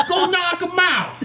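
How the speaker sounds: high, drawn-out send-off; quoting the boxing coach. Retold or imitated. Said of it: "That's his exact words".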